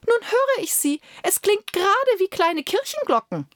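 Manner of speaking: high, pompous delighted cleric; preacher gushing over the sound; enraptured court preacher. Acted. Court preacher voiced in story.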